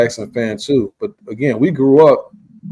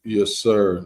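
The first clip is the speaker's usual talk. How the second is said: ordinary talk, nothing marked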